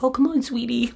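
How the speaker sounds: high, syrupy mock coaxing; fake sweetness. Dad coaxes daughter at the hospital, mock sweetness. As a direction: coo it like a parent putting on a show.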